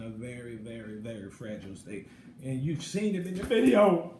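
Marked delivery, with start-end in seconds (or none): 2.4-4.1 louder, insistent point; emphatic. Real, not acted. Vlogger insisting viewers saw it, raised voice.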